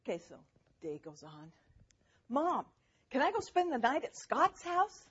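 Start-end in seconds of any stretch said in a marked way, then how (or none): 2.3-5.1 put-on high, eager child asking Mom; imitated child. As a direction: pipe up like a kid asking permission. Voices child asking mother.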